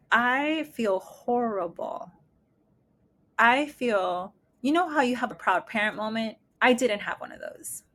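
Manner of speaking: normal talking voice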